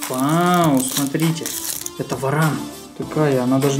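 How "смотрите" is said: normal talking voice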